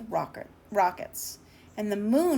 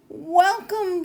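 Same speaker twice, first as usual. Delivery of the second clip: higher and louder greeting; glad welcome; 8 semitones higher, 4 dB louder than usual. Acted. Moon greeting the astronauts, high, loud and glad. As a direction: call out a happy hello.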